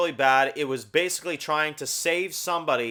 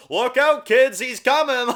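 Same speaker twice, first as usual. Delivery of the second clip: much higher, louder, slowed spooky warning; spooky mock warning; 9 semitones higher, 7 dB louder than usual. Mocking the Nightingale kidnapper, hammed-up spooky. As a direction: ham it up like a campfire boogeyman tale. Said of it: "calling himself Nightingale".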